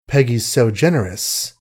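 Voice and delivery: ordinary delivery, nothing marked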